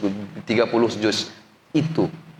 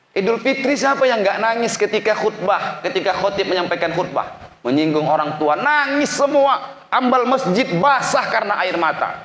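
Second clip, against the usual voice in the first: very high-pitched sermon cry; impassioned preaching; 3 dB louder than usual. Real, not acted. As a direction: preach at full force, stretching key words. Preaches at full force.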